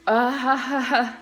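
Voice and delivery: imitated awkward little chuckle; laughing it off. Customer to the barista after being told she's not local, laughing it off. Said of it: "kinda just laughed it off".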